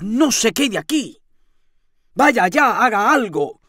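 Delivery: urgent command. A narrator's reading. Landlady ordering the lodger to act, urgently. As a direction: push him into action.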